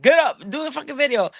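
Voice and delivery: high, bossy imitation of a pushy friend; mock pep push. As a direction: bark him back to work, half joking. Quoting Henry pushing him.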